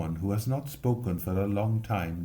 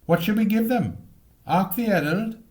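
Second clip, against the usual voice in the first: put-on much higher, a small boy's anxious question; nervous boy; 10 semitones higher, 5 dB louder than usual. Boy whispering to friends at the dark door, anxious and high. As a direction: ask it like a jittery child.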